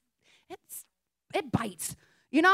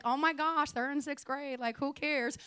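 quiet, amused aside under the breath; amused murmur; 7 dB quieter than usual. Retold or imitated. Pastor at a sixth grade game, a quiet amused aside. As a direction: mutter it to yourself with a chuckle. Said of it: "laughing, like".

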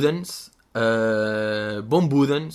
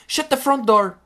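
high raised "Shut the front door."; prim substitute curse; 12 semitones higher, 5 dB louder, slower than usual. Shown as a demonstration. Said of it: "Não se pode dizer isto".

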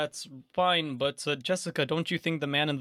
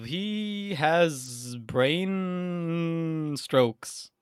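very slow, stretched deadpan; drawn out; 2 semitones higher, much slower than usual. Acted. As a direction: stretch every word for comic effect. GM drags out the joke line.

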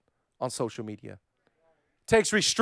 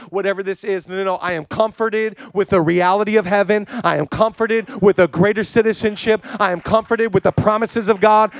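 loud, high, driving sermon delivery; full-force preaching; 7 semitones higher, 11 dB louder than usual. Real, not acted. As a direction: build to a ringing declaration.

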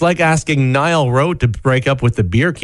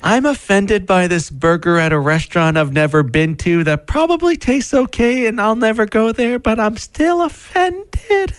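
mock offended complainer; 10 semitones higher than usual. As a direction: whine it out, rising in outrage.

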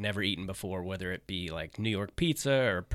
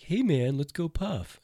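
higher, laid-back buddy greeting; Craig's greeting; 7 semitones higher, slower than usual. Retold or imitated. Imitating Craig offering to smoke, lightly higher and easygoing. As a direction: invite them to smoke, easygoing. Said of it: "he was always like".

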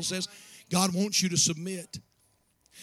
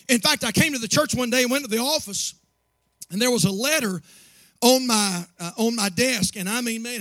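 louder, higher storytelling from the pulpit; preaching a story, building; 5 semitones higher, 4 dB louder than usual. Acted. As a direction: build the tension of the letter. Rising sermon story.

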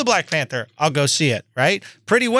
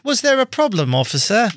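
higher-pitched mock British accent; put-on British accent; 5 semitones higher than usual. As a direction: play the polite British motorist to a cop, accent laid on. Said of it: "He won't stop that fucking accent".

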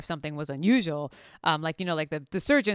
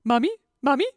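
high, louder mock little cry of mommy; a playful imitation of a chick calling its mother; duckling calling for mom; 5 semitones higher, 6 dB louder than usual. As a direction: call like a lost duckling. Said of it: "we were laughing about that".

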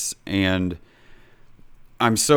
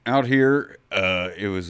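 mock old-timer, pitched up; old man. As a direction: reminisce like a hammed-up old man. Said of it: "You sound like an old man".

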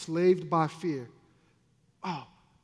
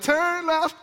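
high, sustained sing-song robot notes; imitation auto-tune voice; 9 semitones higher, 4 dB louder than usual. GPS app giving directions in an auto-tuned singer's voice, imitated. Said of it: "He had the auto-tune voice".

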